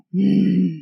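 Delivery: short growly sigh; growling sigh. Shown as a demonstration. Showing the sighs God tells apart, a growling sigh. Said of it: "every growling sigh".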